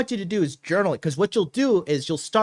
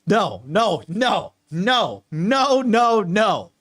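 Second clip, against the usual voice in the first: rising string of emphatic nos, getting louder; 4 semitones higher, 3 dB louder, much slower than usual. Real, not acted. Coach warning listeners off stalking, rising emphatic nos. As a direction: shut it down hard, each no louder.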